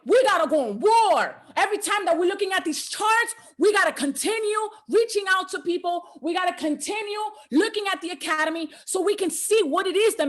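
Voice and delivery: full-force sermon voice; fired-up preaching. Acted. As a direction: push them into battle. Preaching rally for the members.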